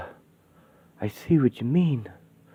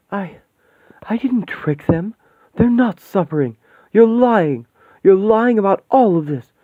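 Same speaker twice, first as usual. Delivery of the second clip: high, loud, furious denial; angry denial; 7 semitones higher, 6 dB louder than usual. Acted. Woman defying the demon, furious and loud. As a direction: throw the lie back at him.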